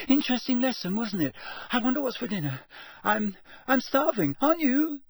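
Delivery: very high, gabbling small talk; unnaturally high. A narrator's reading. Shaken boy makes small talk, unnaturally high. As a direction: cover the upset in a squeaky high voice. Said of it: "his voice much higher than usual".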